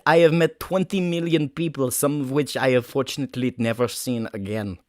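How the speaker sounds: wobbly, hammed-up Russian accent. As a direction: tell a grand life story in mock Russian. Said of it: "Russian, so I'm gonna work with that".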